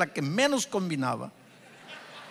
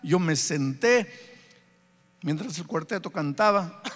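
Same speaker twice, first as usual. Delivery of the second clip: ordinary talk, nothing marked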